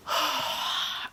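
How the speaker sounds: long, shaky emotional sigh; real weary sigh; heavy sigh. Real, not acted. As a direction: let out a deep sigh of feeling. Emotional after finishing book.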